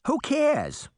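bright high cheer; cheerful. Acted. Fanny cheering up the worried girls. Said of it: "Fanny cheered".

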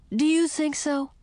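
put-on high-pitched young princess voice; indignant princess. Princess answering Frog's warning, high indignant voice.

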